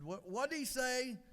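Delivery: slow, high-pitched, puzzled demand; a little frustrated. Acted. Wife questions her husband about the attendant, a little frustrated. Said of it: "a little frustrated and said".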